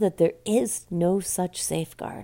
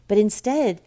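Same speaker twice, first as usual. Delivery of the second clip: ordinary talk, nothing marked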